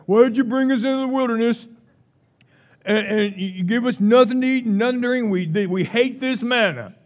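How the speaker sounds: preacher hamming up the Israelites' complaint, mock whining; whiny griping Israelites. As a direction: whine it like a spoiled crowd.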